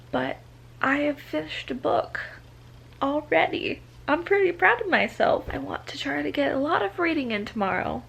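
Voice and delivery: soft late night whisper. Real, not acted. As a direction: whisper so nobody wakes.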